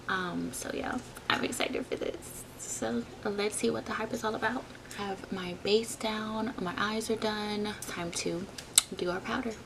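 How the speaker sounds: very soft hushed whisper. Real, not acted. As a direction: keep it barely above a breath. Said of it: "Yes, I'm whispering".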